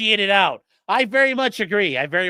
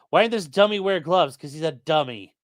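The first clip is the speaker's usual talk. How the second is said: ordinary talk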